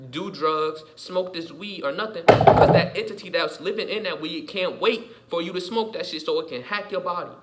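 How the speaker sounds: usual speaking voice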